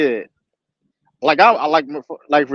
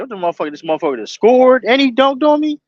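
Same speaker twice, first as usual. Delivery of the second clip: retold angry rant; 7 semitones higher than usual. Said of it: "I'm mad at that point".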